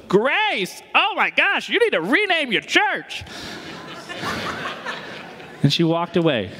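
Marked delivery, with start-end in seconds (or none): 0.1-3.2 put-on much higher, incredulous older lady; Santa Monica lady. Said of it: "older Santa Monica lady who followed us back there goes". 3.3-5.2 airy laugh. Real, not acted. After the lady's quip.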